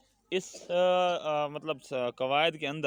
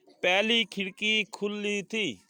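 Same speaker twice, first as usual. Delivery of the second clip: slow, raised-pitch classroom reading; measured dictation pace, pitched up; slow model reading; 5 semitones higher, slower than usual. Shown as a demonstration. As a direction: read it out slowly for pupils to repeat. Reads sentence for class to repeat.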